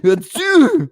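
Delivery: loud, high fake sneeze; mock sneeze. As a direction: explode into a pretend achoo. Imitates a stranger's sneeze.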